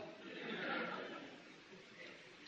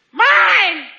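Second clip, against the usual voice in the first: put-on screaming toddler; 12 semitones higher, much slower than usual. Toddler screaming at siblings over a toy, shrill. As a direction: shriek like a grabby toddler. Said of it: "the three-year-old says".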